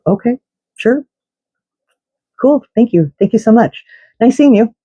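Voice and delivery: high, bright mock politeness over hidden anger; fake cheerful thanks. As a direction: sing out a sugary thank-you while fuming inside. Her fake-polite reply to the friend.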